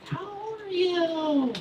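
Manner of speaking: put-on high, drawn-out little-kid voice; Tommy. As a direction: imitate a nosy first-grader, slowly. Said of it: "he's, like, first grade".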